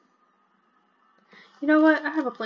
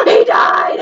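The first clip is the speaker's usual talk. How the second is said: real shriek of shocked glee, much higher and louder; shocked shout; 12 semitones higher, 9 dB louder than usual. Real, not acted. Player seeing her Sim die, shrieking in glee. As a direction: yell it out in gleeful shock.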